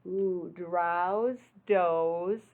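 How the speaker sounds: usual speaking voice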